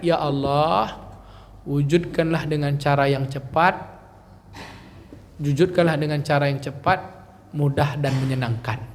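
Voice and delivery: low, earnest prayerful recitation; prayer reading. Shown as a demonstration. Teacher shows the class how to pray the affirmation, reverent. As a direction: recite the prayer softly and devoutly.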